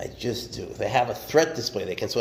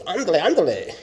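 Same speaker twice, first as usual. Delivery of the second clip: higher, louder mock Mexican Spanish cheer; Mexican Spanish accent; 7 semitones higher, 5 dB louder than usual. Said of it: "Mexican one, he goes".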